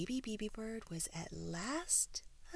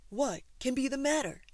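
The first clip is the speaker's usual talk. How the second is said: higher, louder, concerned voice; worried zookeeper; 6 semitones higher, 7 dB louder than usual. A narrator's reading. Keeper finding the tired zoo, worried and loud. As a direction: fret aloud as the keeper.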